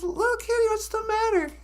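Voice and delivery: high-pitched worried question; concerned skunk. Acted. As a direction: ask a little friend what's wrong, voice up high. Skunk asks sad friend what's wrong.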